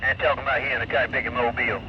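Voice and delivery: trucker talk through CB static; over cb radio. Real, not acted. CB radio clip playing.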